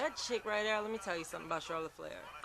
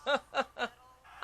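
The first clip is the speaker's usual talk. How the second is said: laughing. Retold or imitated. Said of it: "Rhea Ripley's like".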